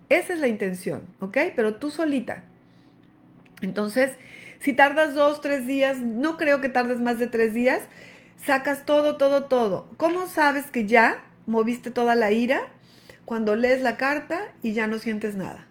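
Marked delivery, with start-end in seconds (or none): none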